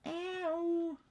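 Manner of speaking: high squeaky cat ow; cat meow as ow. Retold or imitated. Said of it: "sounds like she's saying".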